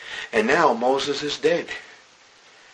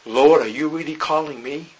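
ordinary talk

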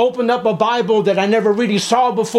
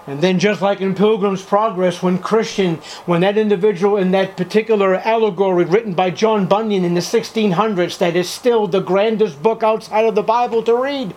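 normal talking voice

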